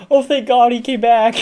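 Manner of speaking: high, loud hammed-up relief; mock relief. Kid seeing the elf moved back, high loud mock relief. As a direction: gush it like an overjoyed little kid. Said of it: "I was like".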